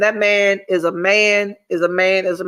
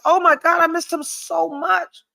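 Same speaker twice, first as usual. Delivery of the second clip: higher, half-joking yearning; mock longing; 6 semitones higher than usual. Quoting Julia to viewers, a high mock longing. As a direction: act out missing him, pitched up. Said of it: "Julia said".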